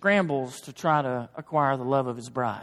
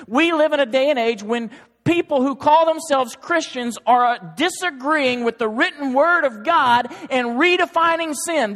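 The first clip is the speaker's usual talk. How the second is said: higher, louder full-force sermon; fiery preaching; 8 semitones higher, 6 dB louder than usual. Acted. Preacher warning the church about heresy, higher and louder. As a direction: thunder the warning at the pews. Preaching against redefining sin.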